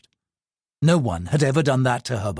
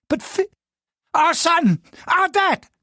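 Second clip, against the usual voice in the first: frightened; slower than usual. Acted. Frightened servants.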